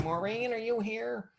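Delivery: high, slow call into a neighbor's home; lightly sung-out neighbor's greeting; calling into the house. Retold or imitated. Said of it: "walk in and be like".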